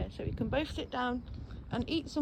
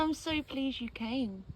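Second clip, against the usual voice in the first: ordinary delivery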